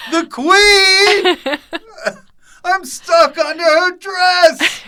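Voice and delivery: very high, loud squealed outcry; mock panic. Acting out panicked reactions, laughing.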